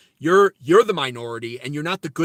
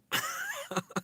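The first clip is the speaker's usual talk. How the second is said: high, soft giggle; laughing; 11 dB quieter than usual. Real, not acted. Streamer cracks up after mocking calm-down comments, high giggle. Laughs at mocked chat reply.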